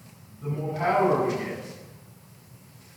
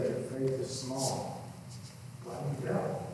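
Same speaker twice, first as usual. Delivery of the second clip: soft rebuke; 3 semitones lower, 11 dB quieter than usual. Acted. Scripture read: Jesus rebukes Peter.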